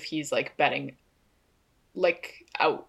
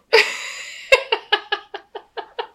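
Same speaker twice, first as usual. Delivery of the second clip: high giggle; 4 dB louder than usual. Real, not acted. Laughing at the joke.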